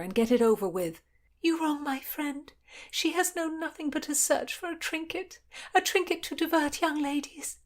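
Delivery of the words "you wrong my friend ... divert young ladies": put-on high-pitched girl pleading her exile; young noblewoman announcing her flight; young woman's voice. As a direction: voice her higher, earnest and urgent.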